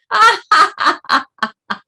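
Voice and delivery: loud high laughter. Real, not acted. Laughter meditation.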